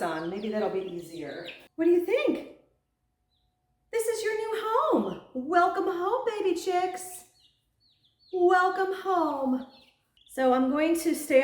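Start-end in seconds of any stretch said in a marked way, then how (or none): 1.7-9.7 high, sweet welcoming coo. Real, not acted. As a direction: welcome the babies home sweetly. Welcoming chicks in baby-talk.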